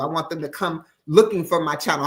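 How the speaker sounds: usual speaking voice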